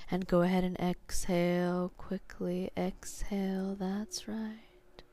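sleep story narrator. Acted. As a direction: guide them down into sleep, slowly. Guided hypnosis induction.

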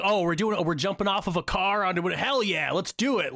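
high-pitched, mock war boy enthusiasm; stoked. Imitating war boys about to jump off a car, stoked. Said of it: "they're just stoked".

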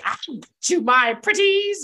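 high, loud drawn-out cackly taunt; screechy storybook villain threat; villainous threat. Acted. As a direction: call out slowly in a high, gloating villain voice. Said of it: "something like ... except in her accent and not mine".

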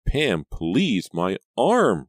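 high, loud protest; acted yelp of pain in words; pained cry. A narrator's reading. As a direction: cry out from a hurt arm. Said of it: "Reva cried".

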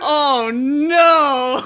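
loud, laughing mock wail "Oh no!"; Muppets' despair. Retelling the Muppets learning Jim died, a loud mock wail. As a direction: wail it out, hammed-up grief. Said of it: "It's like".

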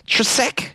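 TRESEK as a high, questioning two-syllable guess; unsure pronunciation attempt. Real, not acted. Host guessing at a patron's surname, unsure: "Tresek" in two syllables. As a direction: sound out "Tresek" as tre-SEK, rising. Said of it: "How do you say this?".

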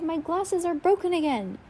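high, lifted despairing cry; upset boy lamenting; distressed wail. A narrator's reading. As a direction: wail it in dismay. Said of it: "Klaus cried".

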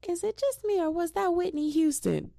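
put-on very high squeaky Southern little girl voice; Southern accent. Quoting the little girl's Southern accent. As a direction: do the tiny Southern girl again. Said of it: "Little Mamas literally said".

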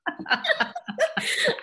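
big high-pitched laugh. Real, not acted. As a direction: burst out laughing. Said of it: "laughs at diapers joke".

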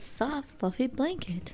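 Siri voice. Shown as a demonstration. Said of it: "You sounded like a Siri".

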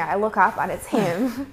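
ordinary talk, nothing marked

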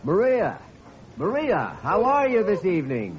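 greeting a friend at a corner table across the room; calling out. Acted. Greets her across the restaurant.

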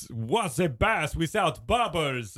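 slow, drawn-out comedian's set-up; Rodney Dangerfield. Acted. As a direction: ham up the line as a celebrity impression. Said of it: "I do a great impression of Rodney".

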